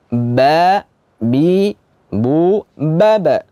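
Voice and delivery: ba bi bu ba clearly from both lips; ba drill. Shown as a demonstration. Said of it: "Huruf ba itu keluar dari dua bibir".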